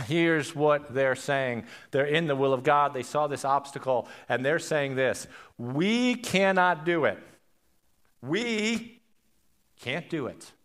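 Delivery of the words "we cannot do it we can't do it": high, slow protest on "We"; emphatic refusal. Acted. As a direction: declare defeat with a drawn-out, raised "We". Voices the people refusing at obstacle.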